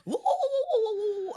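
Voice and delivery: high-pitched monkey whooping; monkey hoots. Acted. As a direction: hoot high like an excited monkey. Demonstrating the monkey noise of the joke.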